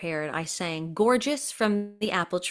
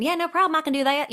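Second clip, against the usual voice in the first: put-on high-pitched, louder Southern accent; 10 semitones higher, 5 dB louder, faster than usual. Volunteering at school for the part, Southern accent. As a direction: agree readily in a Southern accent. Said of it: "can you do a Southern accent".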